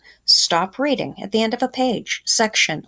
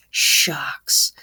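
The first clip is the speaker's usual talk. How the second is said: lower, whispered disappointed shucks; 4 semitones lower than usual. Acted. Boy finds the line too long, disappointed whisper. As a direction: breathe out the letdown in a whisper.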